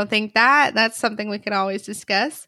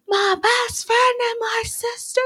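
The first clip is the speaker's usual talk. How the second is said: imitated yell; 14 semitones higher than usual. Host retelling Ross catching the couple kissing, mock yell. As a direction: yell it high and strained, imitating him. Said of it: "he yells".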